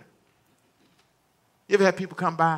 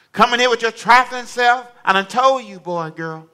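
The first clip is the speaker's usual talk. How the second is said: loud, high scolding mother; a mama calling her child in, fed up; 8 semitones higher, 7 dB louder than usual. Retold or imitated. Preacher voicing a scolding mama.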